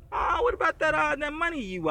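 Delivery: high-pitched, hesitant mock request; awkward asking. Seller nudging a friend about owed money, awkwardly hesitant. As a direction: tiptoe round asking for the money back.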